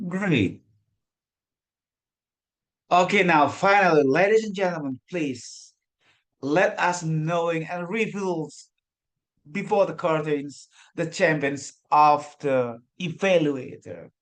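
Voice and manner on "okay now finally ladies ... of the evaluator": pageant host. Acted. Final winner reveal.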